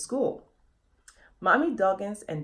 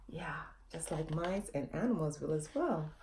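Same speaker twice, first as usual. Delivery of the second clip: whisper; 4 semitones lower, 10 dB quieter than usual. Real, not acted. Aside whisper to children.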